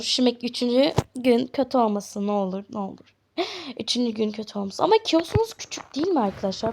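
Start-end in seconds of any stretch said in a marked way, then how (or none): none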